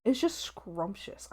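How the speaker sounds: slow, soft, breathy savoring; relishing whisper. Real, not acted. Streamer savoring taser sounds, slow whisper. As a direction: whisper it like tasting something delicious. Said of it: "I love a good taser sound".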